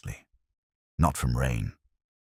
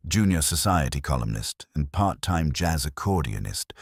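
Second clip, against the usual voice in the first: plain reading voice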